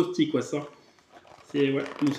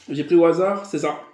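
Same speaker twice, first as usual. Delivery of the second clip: ordinary talk, nothing marked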